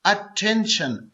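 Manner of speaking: Attention slowly, lightly parted as अ-टेंशन; syllable by syllable. Shown as a demonstration. Teacher repeating a three-syllable word, attention said slowly. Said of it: "ये three syllable word है".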